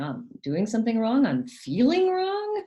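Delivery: high, rising questioning voice; bewildered child. Acted. Confused child's thoughts voiced.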